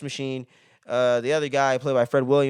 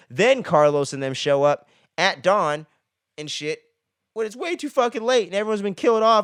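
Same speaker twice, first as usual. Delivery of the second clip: ordinary talk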